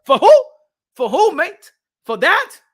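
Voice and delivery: high, loud mock-outraged fans; indignant Australian fans. Voicing Australian fans refusing the stadium fight, high mock indignation. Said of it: "The Australian people gonna be like".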